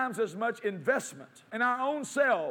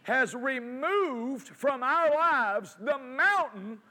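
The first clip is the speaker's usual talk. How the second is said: higher, slow, drawn-out proclamation; impassioned preaching; 5 semitones higher, slower than usual. Real, not acted. Preacher declaring the mountain removed to congregation, impassioned. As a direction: proclaim it with rising force, stretching the phrases. Preacher builds to full force.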